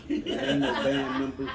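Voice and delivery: slow, loud laughing line. Real, not acted. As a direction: laugh through it, drawn out. Laughing outburst.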